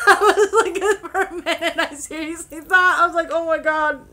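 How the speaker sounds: high, loud laughing words. Real, not acted. Laughing while retelling awkward misunderstanding.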